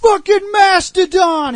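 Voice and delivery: high, loud, slow transformation call; hammed-up hero shout; mock battle cry. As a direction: bellow it like a ranger morphing. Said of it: "he was gonna be like".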